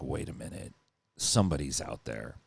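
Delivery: hushed, breathy whisper. Retold or imitated. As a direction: whisper it in sudden alarm. Realizing an intruder outside.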